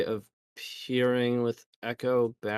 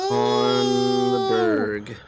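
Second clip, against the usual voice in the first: anguished wail; 7 dB louder, much slower than usual. Acted. Opponent removes their key creature.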